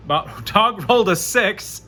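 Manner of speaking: ordinary talk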